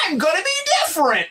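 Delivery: high, mock-earnest young recruit; naive young self. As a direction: play your naive nineteen-year-old self. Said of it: "And I'm like".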